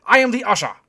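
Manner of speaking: put-on high, loud German-accented proclamation; announcing. A narrator's reading. German doorman returning as usher, loud accented announcement. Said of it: "he announced".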